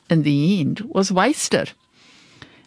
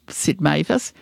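plain reading voice

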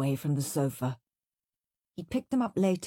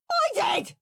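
screaming. A narrator's reading. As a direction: scream it back at your brother. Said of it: "screamed Henry".

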